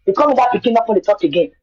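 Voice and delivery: usual speaking voice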